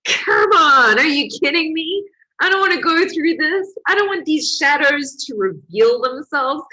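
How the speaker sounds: high, loud complaining voice; whiny inner protest. Retold or imitated. Inner voice refusing to face old wounds, whining loudly. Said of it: "And it's like".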